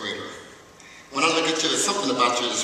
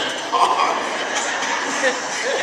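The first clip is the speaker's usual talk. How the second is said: a high breathy laugh; 12 semitones higher, 4 dB louder than usual. Real, not acted. Speaker laughs at the granddad joke, high laugh. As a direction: let a big laugh rip.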